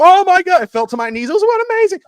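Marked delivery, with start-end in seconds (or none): 0.0-0.6 high, loud disbelieving cry; retold excited yell. Fan reliving the touchdown on his knees, high loud yell. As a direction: explode with joy at the play. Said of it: "and I was like".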